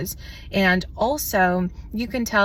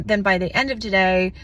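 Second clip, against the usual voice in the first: ordinary talk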